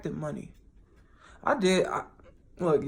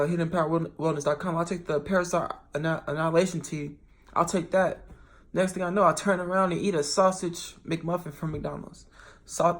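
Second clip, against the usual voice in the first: normal talking voice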